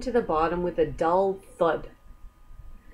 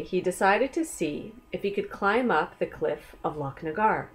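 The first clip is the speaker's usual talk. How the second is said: ordinary delivery, nothing marked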